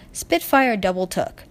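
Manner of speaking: usual speaking voice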